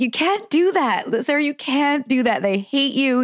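lightly acted scolding, high and urgent; Nippy. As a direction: imitate a companion's mild protest. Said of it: "And then Nippy's like".

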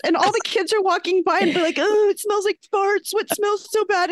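high, mock-distressed retelling; hammed-up high-pitched complaint; upset daughter. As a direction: imitate a mortified preteen quoting classmates. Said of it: "really upset".

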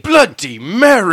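put-on loud, slow, high English accent on "Bloody Mary". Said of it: "if you say it with an English accent".

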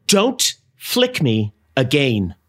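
drawn-out slow warning; each word slowed, like a threat. Acted. Sibling squabble, slow warning.

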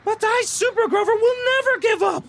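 high, loud superhero boast; heroic proclamation. Acted. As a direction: proclaim it like a caped hero. Hero's defiant proclamation.

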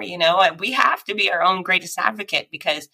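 normal talking voice

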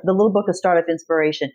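usual speaking voice

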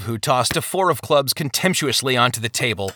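ordinary delivery

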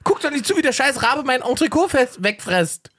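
high, indignant mock scolding; retold scolding of dog. Owner scolding his dog for letting the raven steal his steak, mock outrage. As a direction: tell the useless dog off, outraged but half laughing. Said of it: "ich sag".

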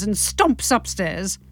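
ordinary delivery

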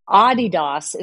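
Adidas as AH-dee-dahs; European pronunciation. Shown as a demonstration. Guest answering the naming question, Adidas as AH-dee-dahs. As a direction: say Adidas like the name Adi plus Das. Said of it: "it's Adi Das when you're in Europe".

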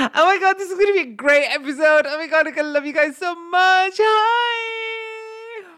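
high, loud, squealing podcast-host gush; hammed-up delight, building to a long squealed "hi"; fake gushing excitement. Podcast host greeting listeners with fake excitement, gushing.

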